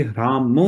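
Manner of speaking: ह्राम with a full m at the end; ह्राम ending in a plain m sound; wrong way. Shown as a demonstration. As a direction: say ह्राम closing on the lips. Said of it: "ये actual pronunciation ये नहीं है".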